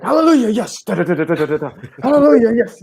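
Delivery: high, shouted hallelujah cheer; hype man cheer. Pretend. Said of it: "I'll just be like".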